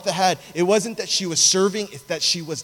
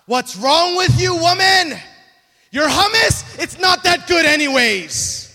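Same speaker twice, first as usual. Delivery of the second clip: hammed-up outraged yelling, high and loud; angry shouting; 11 semitones higher, 8 dB louder than usual. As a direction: shout it slowly in mock fury. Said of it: "he's not angrily saying something where he goes".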